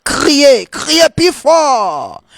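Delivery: high, drawn-out preacher's cry. Real, not acted. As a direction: cry it out high and let each call fall slowly. Same call repeated, now cried out.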